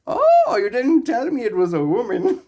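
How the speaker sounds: high, loud, startled character voice; quoting a TV scene. Retold or imitated. Quoting a Sunny in Philadelphia scene for chat, shocked. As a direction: act out the shocked line. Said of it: "quote from Sunny in Philadelphia".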